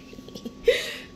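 high, breathy burst of laughter; giggling at herself; squeaky laugh. Real, not acted. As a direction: let out a very high airy laugh at yourself. Laughing at her own delivery.

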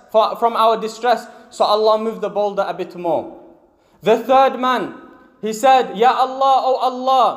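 ordinary delivery

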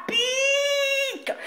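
tiny piercing squeak; sudden high squeal on pique; squeaky high. Acted. Stings with squeaky voice.

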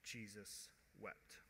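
very soft, slow, low reading; quiet, weighty verse; solemn. Real, not acted. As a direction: let it fall hushed and slow.

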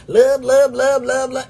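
mock liking. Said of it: "rolling through all my posts".

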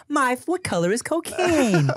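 put-on high, cute children's host voice; Miss Rachel. Mock kids' host quizzing toddlers, high and singsong. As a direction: quiz the toddlers in a high, pure voice. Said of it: "pure voice".

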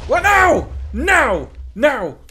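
high, loud cry of no; alarmed shout. Real, not acted. Watching something go wrong in game, shouted no. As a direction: yell it in real alarm. Reacting to sudden danger.

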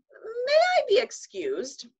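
high, slow, strained with a laugh about to burst; holding in laughter. A narrator's reading. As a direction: squeeze the words out before the laugh breaks.

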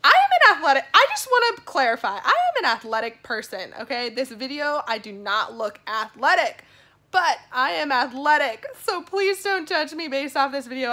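loud, high, insistent protest; emphatic. Real, not acted. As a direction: insist it's true, half laughing. Insists she is athletic.

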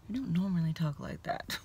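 soft embarrassed whisper; whispered aside. Real, not acted. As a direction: confide it under your breath.

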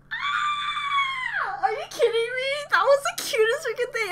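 high-pitched thrilled shriek that melts into words; excited squeal. Real, not acted. Reacting to a cute performance, high excited squeal. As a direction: squeal like the cuteness is too much.

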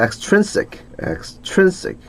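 "Extrinsic" with the middle syllable "trin" hit high; "extrinsic", stressed on the second syllable. Shown as a demonstration. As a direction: lift and punch the "trin" of "extrinsic". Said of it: "트렌의 강세가 있습니다".

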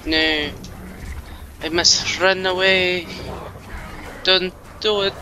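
mock-dramatic Tommy Wiseau pleading. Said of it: "That's my Tommy Wiseau impression".

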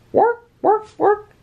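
high, chirpy chanting alpacas; squeaky little worker chant; high alpaca shout. A narrator's reading. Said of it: "shouted the alpacas".

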